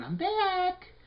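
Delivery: very high, loud put-on menace; sinister tumor voice. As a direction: announce the comeback like a cartoon villain. Tumor voiced announcing return.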